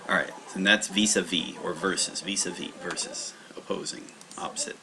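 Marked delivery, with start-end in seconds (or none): 2.2-4.8 soft whispered repeat. Shown as a demonstration. Teacher quietly whispering the meanings back to himself, whisper. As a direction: breathe the words as a whisper to yourself.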